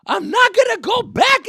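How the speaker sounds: high, loud, slow whine; whiny refusal. Retold or imitated. Son refusing to go back, high whiny shout. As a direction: dig in and refuse like a scared kid.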